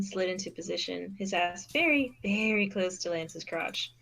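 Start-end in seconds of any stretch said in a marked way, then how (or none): none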